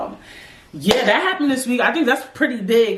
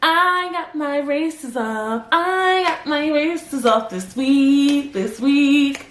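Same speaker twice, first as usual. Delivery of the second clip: slow showing-off; 5 semitones higher, much slower than usual. Shown as a demonstration. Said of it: "showing off her new teeth".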